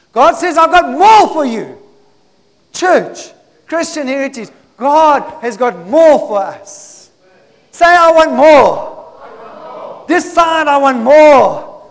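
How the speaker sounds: loud, high rallying cry; full-force preaching, big drawn-out key words; rousing preacher's call. Real, not acted. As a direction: fire up the church to shout back. Rallies the church to repeat.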